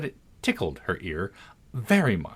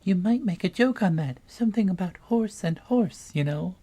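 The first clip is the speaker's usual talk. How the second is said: much higher little gnat voice; tiny voice; 9 semitones higher than usual. A narrator's reading. Tiny gnat at Alice's ear suggesting a pun, very high. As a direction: whisper a joke in her ear. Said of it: "An extremely small voice close to her ear".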